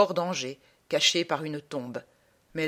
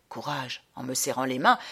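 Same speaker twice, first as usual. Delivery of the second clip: put-on consoling old man; 5 semitones lower than usual. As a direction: murmur comfort to the bereaved. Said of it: "Un vieux me fait".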